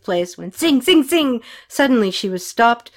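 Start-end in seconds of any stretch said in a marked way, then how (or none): 0.5-1.4 high, loud ringing zings; pitched-up bullet noises; imitated bullets. A narrator's reading. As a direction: make bullets fly past.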